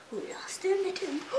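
soft secret whisper of the title; mother whispering the book title to her son. Pretend.

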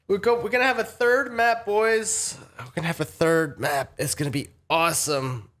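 genuinely pumped about the new map; excited. Real, not acted. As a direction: gush with real hype. Hyped about a third map.

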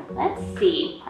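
usual speaking voice

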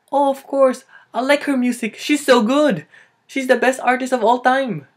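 high, loud gushing; over-excited mock fan voice; enthusiastic Filipino fan. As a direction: gush loudly at a high pitch. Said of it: "you're asking somebody".

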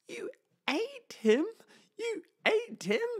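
very high, crying disbelief; shocked crying. Acted. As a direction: wail it out in horror, very high. Bird learns friend was eaten.

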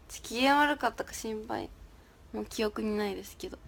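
usual speaking voice

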